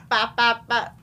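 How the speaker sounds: normal talking voice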